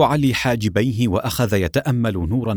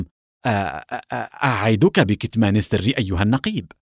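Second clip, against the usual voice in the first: stammering. A narrator's reading. Blushing journalist stammers her promise to the captain. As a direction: stumble over the first sound, then get the promise out. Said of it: "وقالت بتلعثم".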